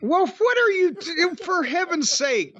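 high indignant squawk; mock-outraged high yelp. Real, not acted. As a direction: sputter at him, offended. Indignant at partner's mockery.

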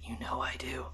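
hushed mock-dramatic whisper. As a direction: whisper back with hammy gravity. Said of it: "all so over the top and so over dramatic".

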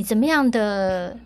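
ordinary talk

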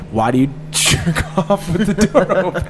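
imagined dog question through laughter; laughing dog voice. Pretend. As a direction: laugh while voicing the dog.

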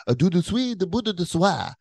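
high-pitched gibberish French; mock French. Shown as a demonstration. Showing co-host how to reply, in high mock French. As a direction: toss out fake French, pitched right up. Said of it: "he sounds authentique".

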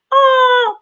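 high, drawn-out awww of pity; mock sympathetic coo. Podcaster recalling watching him cry, a pitying coo. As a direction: coo like you're touched despite yourself. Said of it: "soft sympathetic oh".

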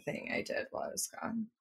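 hushed, teasing whisper. Real, not acted. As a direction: drop to a secretive whisper. Teasing a guess.

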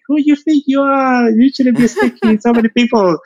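high, mocking scolding voice; inner critic Lolita. High scolding inner critic telling him to stop talking to people. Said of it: "is the Lolita in the back of my head".

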